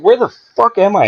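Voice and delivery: bewildered outrage, pitched very high; high, exasperated mock outburst; retold flip-out. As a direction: act out losing it at an absurd refusal. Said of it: "And I flip out".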